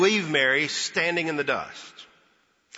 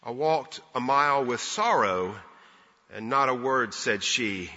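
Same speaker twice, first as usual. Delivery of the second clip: measured, low-pitched poetry reading; poem recitation; 5 semitones lower than usual. Acted. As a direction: recite it gently, line by line with pauses, softening at the very end. Recites a little verse.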